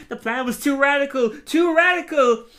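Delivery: high, loud despairing cry, rising; anguished wail. Retold or imitated. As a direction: wail it out as he collapses. Falling to the floor going.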